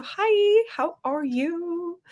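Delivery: high, drawn-out singsong hello; singsong greeting. Real, not acted. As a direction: greet them in a high, stretched-out lilt. Greeting a newcomer in chat.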